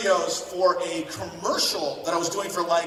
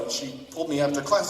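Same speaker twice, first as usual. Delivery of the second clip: ordinary talk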